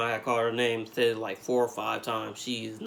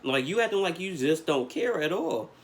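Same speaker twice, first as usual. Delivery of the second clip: higher, elevated irritated tone; 7 semitones higher than usual. Retold or imitated. Confronting his girlfriend, irritated but not yelling. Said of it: "I had my irritated voice on".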